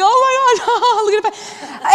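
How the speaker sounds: high, laughing mock teen voice. Teens in the audience laughing at Patrick, high and giggling. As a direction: giggle it out like amused teenagers. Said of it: "busting out laughing".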